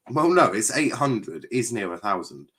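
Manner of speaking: ordinary talk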